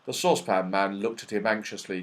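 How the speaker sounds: usual speaking voice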